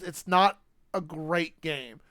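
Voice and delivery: slow, with not hit hard; not a great game, leaning on not; stress on not. Real, not acted. Weighing the game's quality.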